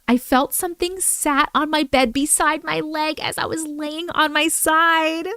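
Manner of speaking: normal talking voice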